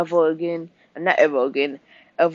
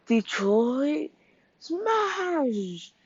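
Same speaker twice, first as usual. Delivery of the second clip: high, long-held battle cry, softly done; hero's attack call; 10 semitones higher, 4 dB quieter, much slower than usual. Acted. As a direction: draw out each word like a superhero's finishing move. All Might calls out his attack.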